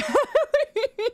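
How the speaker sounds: high-pitched burst of laughter; laughing high. Real, not acted. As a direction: crack up in a high giggling run. Laughs while adding the overlay.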